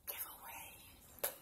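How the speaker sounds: slow, hushed whisper of a forbidden word; secret whisper. Real, not acted. Vlogger teasing viewers about the forbidden word, whispered. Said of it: "the word that you're not allowed to type".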